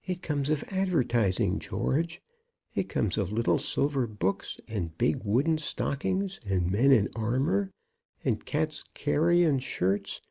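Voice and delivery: plaintive character voice, mildly reproachful; elderly senior partner, complaining. A narrator's reading. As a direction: grumble back in a lifted voice, listing each grievance. Brown retorts about advertising.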